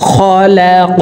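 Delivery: খলাক ending in a sharp upward bounce on the ক; qalqala on qaf. Shown as a demonstration. Teacher showing the upward qalqala bounce, emphatic. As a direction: give খলাক a stopped, bouncing ক. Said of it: "উপরের দিকে ধাবিত করে ধাক্কা দিয়ে উচ্চারণ করে সাকিন করব".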